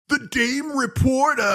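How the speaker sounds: hammed-up dumb-brute delivery; Rocksteady. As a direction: drag it out like a dim-witted thug. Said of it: "Rocksteady goes".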